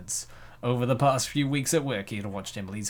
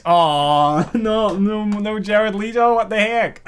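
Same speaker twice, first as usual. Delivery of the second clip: higher, louder laughing outcry; laughing protest; 8 semitones higher, 8 dB louder, slower than usual. Real, not acted. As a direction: cry out in mock dismay. Dismay at Jared Leto reference.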